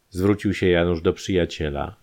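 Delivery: ordinary delivery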